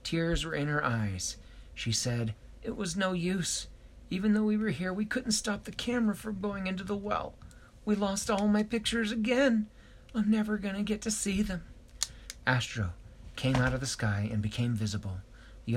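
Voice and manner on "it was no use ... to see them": tearful. A narrator's reading. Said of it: "She was feeling sad".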